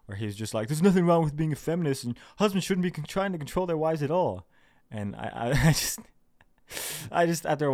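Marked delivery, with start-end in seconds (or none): 0.7-4.5 higher, louder imitation of a commenter; passive-aggressive mock quote. Quoting a commenter's pointed reply, mock passive-aggressive. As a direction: mimic a pointed comment in a raised voice. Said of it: "kind of passive-aggressive comment".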